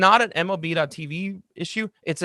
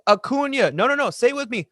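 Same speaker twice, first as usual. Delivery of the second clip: higher, sing-song "Acuna" led like a choir; mock conductor teaching; 8 semitones higher, 3 dB louder than usual. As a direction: lead the crowd through the name. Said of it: "wave it like he's a conductor".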